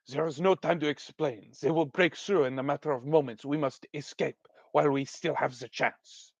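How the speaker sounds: put-on terrible French accent, pressing and hushed; Aline. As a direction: urge escape in a bad French accent. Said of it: "give her a really terrible French accent".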